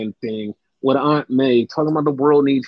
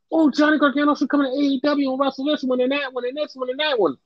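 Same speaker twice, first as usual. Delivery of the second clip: mock gushing fan; 10 semitones higher, faster than usual. Mocks fans gushing.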